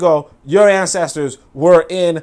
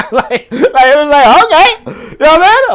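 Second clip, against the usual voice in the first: laughing; 9 semitones higher, 7 dB louder than usual. Real, not acted. Amused reaction to girlfriend.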